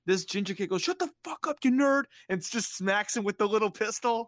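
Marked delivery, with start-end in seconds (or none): 0.8-2.1 high-pitched shout, building louder; mock aggression. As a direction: play a bully yelling a kid down. Ginger kid snaps at crying Alan.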